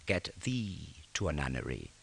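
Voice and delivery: quiet and slow. Shown as a demonstration. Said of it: "¿Os acordáis de esto?".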